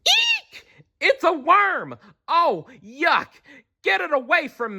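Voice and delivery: very high, squealing disgust; eek, a worm; squeamish shriek. A narrator's reading. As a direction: shriek like a girl who found a worm. Girl finds worm in box.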